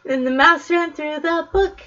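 ordinary delivery

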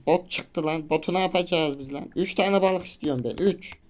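mock outrage, demanding three fish; indignant outburst. Real, not acted. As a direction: protest at the game for the boot. Caught a boot instead.

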